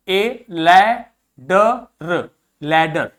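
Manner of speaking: लै, ड, र slowly one by one, then लैडर; sounding out. Shown as a demonstration. Teacher spelling out for learners, slow sounding-out of लैडर. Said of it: "ए का sound ए होता है".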